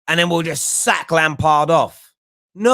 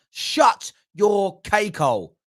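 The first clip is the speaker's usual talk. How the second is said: ordinary talk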